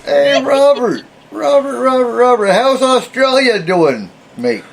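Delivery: high, drawn-out bad Australian call-out; loosely attempted Australian accent, pitched high and slow. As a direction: hail a mate in a botched Aussie accent. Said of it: "That was the worst Australian accent".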